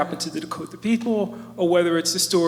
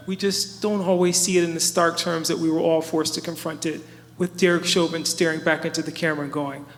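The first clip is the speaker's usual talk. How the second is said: ordinary delivery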